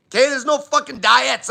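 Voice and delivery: ordinary talk, nothing marked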